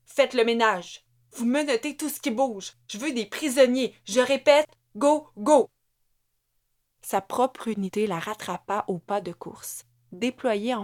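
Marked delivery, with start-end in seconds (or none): 0.1-5.7 loud, rising battle commands; officer bellowing orders during the raid; shouted orders. Acted. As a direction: drive the units forward.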